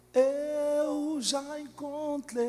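high, slow mocking lisp with a slushy sh for s. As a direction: tease the preacher's lisp, slowly. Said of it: "imitou minha língua presa". (imitação de língua presa)

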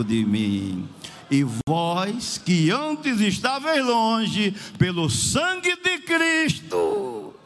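loud, impassioned, stretched-out phrases; full-force preaching. Real, not acted. Preacher brings the dying sister's testimony to its climax, full-force preaching.

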